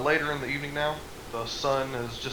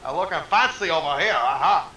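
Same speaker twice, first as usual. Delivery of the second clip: loud, high playful Scottish brogue; Scottish accent; 5 semitones higher, 9 dB louder than usual. Retold or imitated. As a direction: ham up a Scottish turtle. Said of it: "His character's Scottish".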